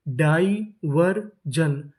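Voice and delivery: Diversion very slow as डाइ, वर, जन; syllable by syllable. Shown as a demonstration. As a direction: sound out diversion with gaps between syllables. Teacher sounding out new word.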